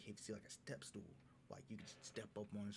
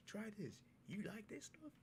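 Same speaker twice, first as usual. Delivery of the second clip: eager; 12 semitones higher than usual. Retold or imitated. Offering drink to Iman.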